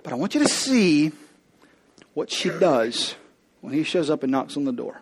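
usual speaking voice

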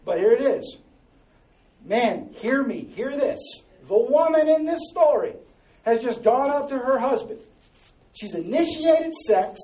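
full-force preacher address; preaching loud. Real, not acted. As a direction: call out to the men in the room. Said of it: "preaching to the men".

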